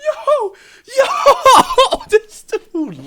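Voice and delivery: high, laughing yo yo. Real, not acted. Laughing in disbelief at Larry's trash talk, high laughing yells. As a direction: crack up while calling out this dude.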